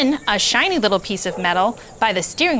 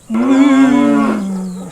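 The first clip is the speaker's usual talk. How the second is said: a long lowing moo, louder; loud cow moo; 6 dB louder than usual. A narrator's reading. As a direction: moo like a cow in a voice not your own. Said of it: "said Cow".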